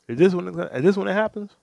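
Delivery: loud and high, eager; excited. Real, not acted. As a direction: lean in, thrilled by the scene. Excited watching the scene.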